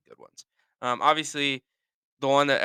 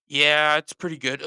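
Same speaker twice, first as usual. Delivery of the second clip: raised, strained reply with a grunt after; imitation of an achy grunter; grunt at sentence end; 3 semitones higher, 6 dB louder than usual. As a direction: act pained while saying it's fine. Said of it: "there's always, like, a grunt".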